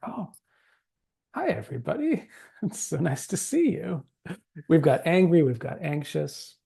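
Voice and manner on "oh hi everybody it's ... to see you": higher-pitched, happy hello with a little laugh; delighted party greeting. Acted. Teacher acting out arriving among the community, delighted. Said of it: "feel like I'm coming to a birthday party".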